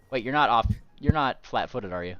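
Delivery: ordinary talk, nothing marked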